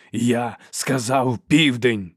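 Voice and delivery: loud, slow, forceful demand; angry bark. A narrator's reading. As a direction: bark it out, each word heavy. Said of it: "гаркнув він". (сердито, гучно гаркнути, карбуючи слова)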